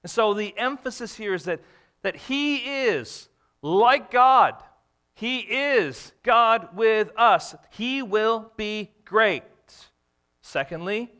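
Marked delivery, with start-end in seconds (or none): 2.0-9.4 slow, loud, higher preaching; emphatic preaching. Real, not acted. Preacher to congregation: slow, emphatic, loud. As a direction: lean hard on every word.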